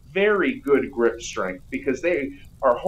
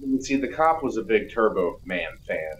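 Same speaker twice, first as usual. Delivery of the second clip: ordinary talk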